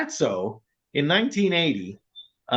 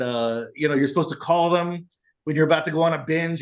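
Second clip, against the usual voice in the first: ordinary talk, nothing marked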